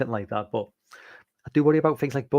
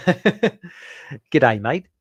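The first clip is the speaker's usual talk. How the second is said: joking Australian "Good day, mate", louder and put on; a hint of Australian accent, as an old joke between friends; mock Australian accent; 6 dB louder, much slower than usual. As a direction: drawl "Good day" like a stock Aussie. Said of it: "still thinks I'm Australian".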